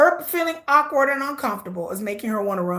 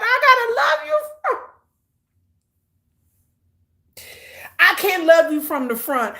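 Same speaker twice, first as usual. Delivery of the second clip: laughing mock quote; 3 semitones higher than usual. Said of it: "She said".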